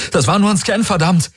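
high, panicked outburst; breathless cry. A narrator's reading. Galen seeing the warrior turned to glass, breathless cry. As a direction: gasp it out with pounding heart. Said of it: "rief Galen atemlos".

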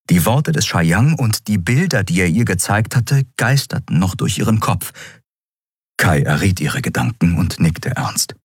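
plain reading voice

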